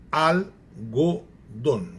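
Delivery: algodão very slow, broken into al, go, don; syllable by syllable. Shown as a demonstration. Teacher showing syllable division, "algodão" said slowly in separate syllables. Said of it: "se separou silabicamente".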